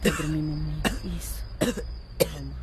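fading hush by the bedside; soothing. Acted. Mother lulls sick son to sleep. (mãe acalma o filho doente, baixinho)

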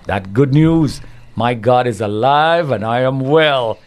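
triumphant sermon close; preaching proclamation. Real, not acted. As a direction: proclaim it like a pulpit climax. Closing testimony, praising God.

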